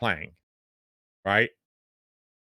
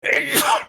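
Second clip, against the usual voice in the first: a sudden loud real sneeze; sneezing; 5 dB louder than usual. Real, not acted. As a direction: sneeze sharply mid-sentence. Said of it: "sorry, I'm gonna sneeze".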